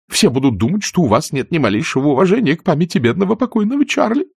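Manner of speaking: put-on high, fluttery elderly aunt voice, shocked; fussy aunt scolding. Pitty pleads. (высокий суетливый голос тётушки, возмущённо)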